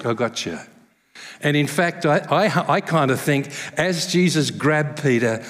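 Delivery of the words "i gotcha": soft, low reassurance; reassuring. Pretend. Jesus catches sinking Peter, soft low reassurance. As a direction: catch him gently. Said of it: "he goes".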